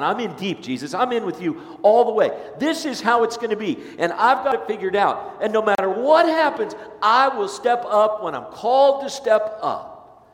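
loud, high bragging vow; boastful pledge. Acted. Peter loudly vowing loyalty to Jesus, boastful shout. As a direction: swear it big and cocky, slowing at the end.

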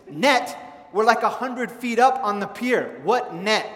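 loud, high, lightly gruff fisherman; gruff scary voice. Retold or imitated. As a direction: bark at the kid like a grumpy fisherman. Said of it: "in a very gruff and a very scary voice".

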